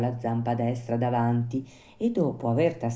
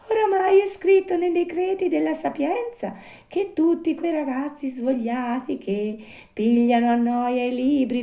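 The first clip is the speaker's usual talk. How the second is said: high little-animal voice, resigned lecture; consoling marmot; 12 semitones higher, 4 dB louder than usual. A narrator's reading. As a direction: console while moralising in a tiny high voice. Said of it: "per consolarlo".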